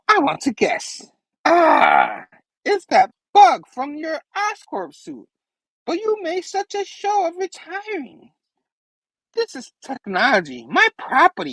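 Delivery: high, theatrical villain with swooping pitch; Goblin. Acted. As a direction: taunt in a squeaky comic-villain voice. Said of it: "He's like".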